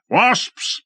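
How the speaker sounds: high alarmed call; warning shout. A narrator's reading. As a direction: warn the men. Lord warns of wasps.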